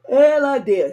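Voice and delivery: words stretched through a yawn. Real, not acted. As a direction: talk right through a big yawn. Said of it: "As soon as I said it".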